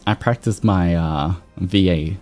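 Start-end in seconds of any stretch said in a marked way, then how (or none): none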